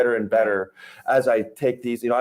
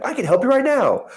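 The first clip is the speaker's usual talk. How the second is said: much higher, pushy salesy inflection; eager new agent; 12 semitones higher than usual. Retold or imitated. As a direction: overeager rookie pitching too hard. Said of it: "there's that inflection".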